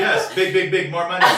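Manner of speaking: high, loud laughing mock Chinese accent. Mimicking the tailor's sales pitch, loud mock Chinese accent. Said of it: "do it in a Chinese accent".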